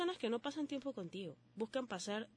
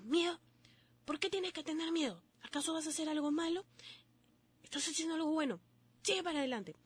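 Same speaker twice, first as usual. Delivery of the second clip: higher, stern self-scolding voice; scolding yourself; 6 semitones higher, faster than usual. Acted. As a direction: tell yourself off like a strict elder. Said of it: "y nos digamos".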